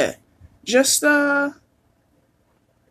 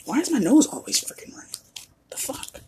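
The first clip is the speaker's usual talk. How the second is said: real whispered gripe, softer; whisper to self; 4 semitones higher, 9 dB quieter than usual. Real, not acted. Podcaster grumbling to herself about her runny nose, whispered. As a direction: breathe it out as an annoyed aside. Muttering to herself mid-shuffle.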